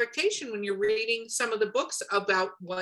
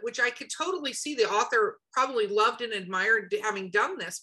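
ordinary talk, nothing marked

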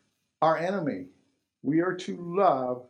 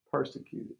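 whispered; 5 semitones lower, 9 dB quieter than usual. Acted. Preacher drops voice on key word.

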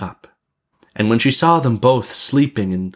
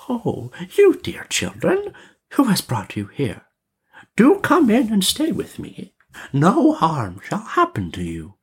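put-on coaxing old witch; 10 semitones higher than usual. A narrator's reading. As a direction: lure the children in sweetly, pitched high. Old woman coaxes the children.

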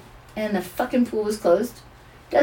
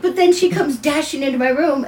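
normal talking voice

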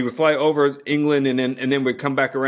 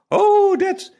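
high, gushing, hammed-up British accent; a bad British accent, thrilled at home below; Piers in British accent; 5 dB louder, much slower than usual. Imitating crewmate gushing over England, hammed-up British accent. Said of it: "I can't do a British accent".

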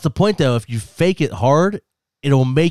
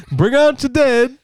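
town crier call; 11 semitones higher, 7 dB louder, slower than usual. Pretend. Monty Python quote.

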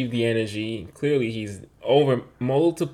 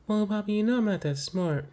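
lightly voiced little boy, higher and softer; voiced young son; 7 semitones higher, 5 dB quieter than usual. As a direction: say it small, like a sheepish kid. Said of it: "And he's like".

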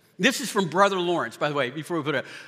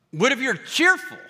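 cheerful; 8 semitones higher, 3 dB louder than usual. Acted. Shifts to a bright cheerful voice.

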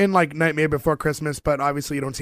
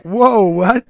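higher, louder mock surprise; astonished reaction; 5 semitones higher, 7 dB louder than usual. Host retelling spotting a Beetlejuice actor, astonished. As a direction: act out a startled double take.

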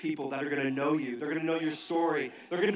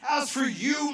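"as for you," flung out higher and louder; rising shout; 8 semitones higher, 4 dB louder, slower than usual. Shown as a demonstration. Pastor repeating the verse to his city church, now shouting it. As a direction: lift "as for you," into a cry. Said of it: "repeated scripture, second take".

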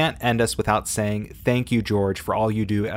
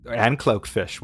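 ordinary talk, nothing marked

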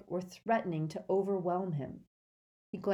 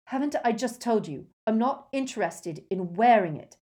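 loud, higher, impatient outburst; heated, raised voice; fierce; 5 semitones higher, 8 dB louder than usual. A narrator's reading. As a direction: cut in with fierce exasperation. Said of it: "Harry said fiercely".

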